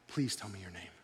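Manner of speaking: soft, low, trembling plea; quiet, low, trembling please. Retold or imitated. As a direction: ask in awed, shaken reverence. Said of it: "You can almost hear the tremble in his voice".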